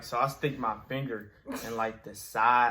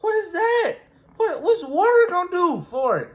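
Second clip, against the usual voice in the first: high, loud disbelieving squeal; can't believe the advice; incredulous; 10 dB louder than usual. Retold or imitated. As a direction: kid retelling, squealing in disbelief. Kid reacting to granddad's advice.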